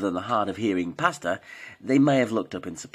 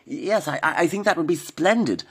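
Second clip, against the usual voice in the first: put-on higher, hesitant, prim elderly lady; polite old lady; 9 semitones higher, 4 dB louder than usual. A narrator's reading. Ethel answers Norman politely, a prim higher old lady. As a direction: stammer politely like a genteel pensioner. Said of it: "replied Ethel Elderberry politely".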